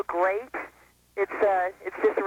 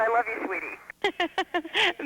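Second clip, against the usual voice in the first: drawn out and higher, tenderly; slow, stretched-out sweet; 6 semitones higher, much slower than usual. Pretend. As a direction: say it slowly and lovingly. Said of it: "Say that last part".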